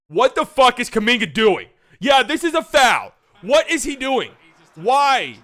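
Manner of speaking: loud, high, exasperated outburst; furious fan yelling at the screen; angry rant. Real, not acted. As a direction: shout it in real disbelief. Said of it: "had me actually angry".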